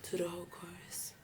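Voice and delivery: unvoiced whisper, barely there. Real, not acted. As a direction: breathe the words without voice. Whispering in the studio booth.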